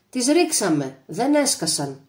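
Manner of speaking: usual speaking voice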